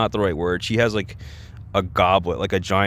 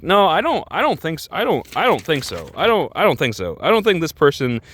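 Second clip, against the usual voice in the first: insistent disagreement; 7 semitones higher than usual. Acted. Acted rebuttal to commenters.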